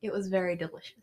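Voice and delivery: soft, put-on British answer; British accent. Telling friends if her food was good, British accent. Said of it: "you're speaking in it the whole time?".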